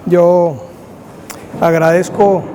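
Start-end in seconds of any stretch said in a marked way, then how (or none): none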